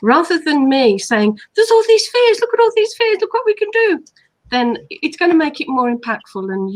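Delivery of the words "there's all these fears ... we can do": mock excited pitch. Mock eager pitch of fears.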